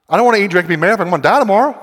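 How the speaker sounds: hurried mock protest with a rising end; mock objection. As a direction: blurt out the objection in one rapid run. Voices an objection to live-it-up slogan.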